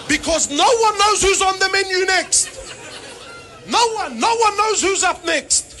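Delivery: shouted punchline over laughter; loud punchline. Acted. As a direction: belt it out to the crowd.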